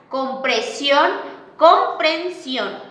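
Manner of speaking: "compresión" then "comprensión", slow, with the added n made clear; slow careful contrast. Shown as a demonstration. Teacher to class, showing an added letter. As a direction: give the extra n its own weight. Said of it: "Omisión o adición de letras".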